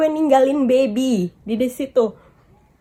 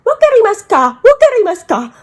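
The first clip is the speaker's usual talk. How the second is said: much higher, louder Wakarimasu ka, repeated; imitating a stranger's loud Wakarimasu ka; mock angry demand; 7 semitones higher, 7 dB louder than usual. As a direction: hammer the question at a foreigner.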